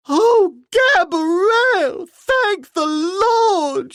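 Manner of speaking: very high, slow wailing cry; high slow sobbing wail; wailing maid. A narrator's reading. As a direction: wail in terror with eyes shut. Maid's voice held throughout.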